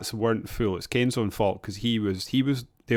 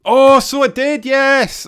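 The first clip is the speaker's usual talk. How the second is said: high, loud burst of recognition; excited realisation; 12 dB louder, slower than usual. Real, not acted. Remembering how the game ended, high and loud with excitement. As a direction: jump up as the memory clicks. Sudden recall of game ending.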